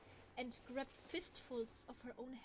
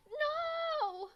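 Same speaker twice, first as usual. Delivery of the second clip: piercing, much higher and louder shriek; broken scream; 15 dB louder than usual. Acted. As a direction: scream like sanity is snapping. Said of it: "Suddenly, June was screaming, her voice broken".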